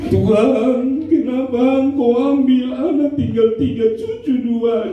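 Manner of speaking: high, slow wailing prayer; hammed-up crying while talking; mock tearful lament. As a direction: wail the words out like a grieving widow. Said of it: "menangis ... kalau menangis dia ngomong".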